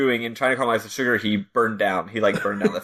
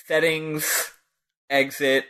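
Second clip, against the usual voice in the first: ordinary talk, nothing marked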